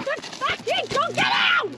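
very high, drawn-out angry scream; screaming fury. Real, not acted. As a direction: scream them out of the car. Screaming during struggle.

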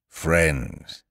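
lightly voiced pompous orator; Eeyore's speech. A narrator's reading. Eeyore beginning his party speech, a touch ponderous. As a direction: open a solemn address. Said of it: "coughed in an impressive way and began to speak".

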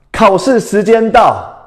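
高声、放慢宣布考试开始; 像老师一样拉高嗓门宣布; 大声宣布. Real, not acted. 老师宣布考试开始. (高声慢慢宣布考试时间到)

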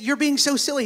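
high-pitched scolding bird; high, incredulous little sparrow; sparrow character. Acted. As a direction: chide in a high cartoon sparrow voice. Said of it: "the sparrow looks at me and goes".

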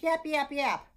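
high, loud yapping; quick yaps like a small dog; little dog yaps. Pretend. Storyteller voicing the little dog, playful high yaps. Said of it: "the little dog goes".

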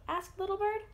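ordinary delivery

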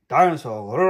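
put-on a loud playful child's roar; mock dinosaur roar. A narrator's reading. To Mummy, a loud pretend roar. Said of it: "roars George".

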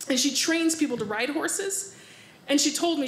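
normal talking voice

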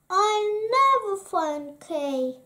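high, loud lament; despairing sigh. A narrator's reading. Captive Gerda losing hope of finding Kay, despairing sigh. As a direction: cry it out in mild despair. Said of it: "she sighed".

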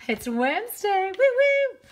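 high, loud singsong cheer; excited cheer. Real, not acted. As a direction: whoop it up for Wednesday. Livestream hype cheer for Wednesday.

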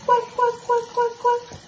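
very high squeaky quacking; mother demonstrating a duck sound to her toddler. Shown as a demonstration. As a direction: play the duck for the baby. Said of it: "Make the sound of the duck".

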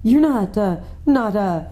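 high, halting, shaky question; nervous girl's trembling stammer. A narrator's reading. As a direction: falter between words, unable to finish. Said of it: "asked Susan in a shaky voice".

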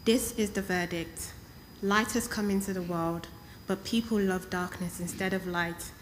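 ordinary talk, nothing marked

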